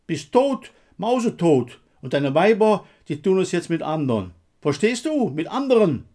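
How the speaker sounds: high, loud, overwrought jeer; agitated, bitter goading; frantic taunting. A narrator's reading. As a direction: rub it in, close to losing control. Taunting his dead comrade.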